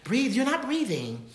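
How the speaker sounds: high, slowed teacher's correction. Retold or imitated. Voice teacher correcting her student's breathing, lightly imitated. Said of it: "She was".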